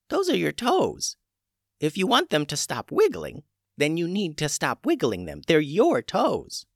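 put-on high, calm old witch; a witch healer in charge. A narrator's reading. Old witch Tubes speaks.

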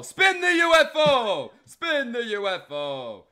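loud, high, drawn-out game-show holler; big playful announcer yell; hype shout. Pretend. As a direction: belt it out slowly, stretching the last sound. Host hypes the UFO donation.

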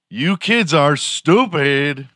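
high, loud, slow jab; exasperated scolding. Real, not acted. Mock scolding of young slang users.

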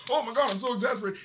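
high, rushed imitation of being exasperated; mock exasperation. Imitating Sinbad doing flop sweat, mock exasperated. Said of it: "trying to do the flop sweat".

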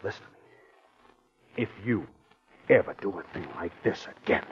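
menacing whisper. Acted. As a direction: whisper it as a threat. Husband threatens wife.

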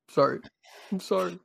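high, hammed-up tearful apology; choked up. Podcast host apologizing to co-host for breaking down, mock tearful. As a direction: apologize as if tears are taking over. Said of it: "getting a little choked up".

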